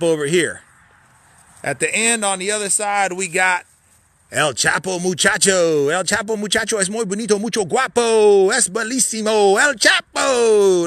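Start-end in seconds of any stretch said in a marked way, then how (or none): 4.3-10.9 hammed-up Spanish flourish, higher and louder; mock Spanish. Host naming the cabin El Chapo in hammed-up mock Spanish. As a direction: play it like a showy Spanish announcer.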